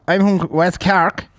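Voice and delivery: slow, high imitation of a Cork accent; a light put-on West Cork accent. A stranger in the pub toilet answering, in a Cork accent. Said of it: "this Cork accent".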